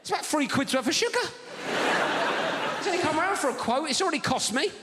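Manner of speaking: high-pitched, incredulous complaint; hammed-up outrage at the cost; mock indignation. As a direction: protest in disbelief, pitched right up. Acted indignation at thirteen sugars.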